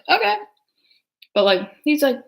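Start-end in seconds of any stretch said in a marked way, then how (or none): 0.1-0.4 surprised high-pitched okay; a sudden high okay; high squeak okay. Retold or imitated. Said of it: "it's like".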